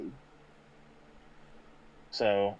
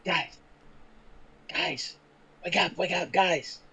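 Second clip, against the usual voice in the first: hushed urgent shout under the breath; whisper yelling; 4 semitones higher, 9 dB quieter than usual. Acted. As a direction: yell it in a whisper so nobody else hears. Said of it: "I sorta start doing that kind of tunnel whisper yell".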